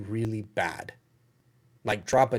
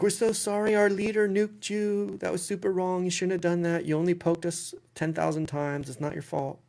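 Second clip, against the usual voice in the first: a hint of a grovelling leader's apology; groveling apology; 8 semitones higher than usual. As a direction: play the simpering apologizer, higher up. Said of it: "They're like".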